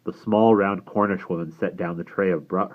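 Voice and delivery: ordinary delivery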